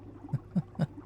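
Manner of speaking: light, quiet run of chuckles; small self-amused laugh; soft villain giggle. Acted. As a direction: titter softly, pleased with yourself. Villain amused at own monologuing.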